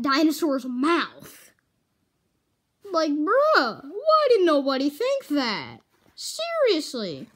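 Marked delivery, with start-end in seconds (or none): 2.9-7.3 loud, incredulous bruh; exasperated rant. Real, not acted. Kid ranting to viewers about robot dinosaur cops, loud. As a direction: throw up your hands in disbelief.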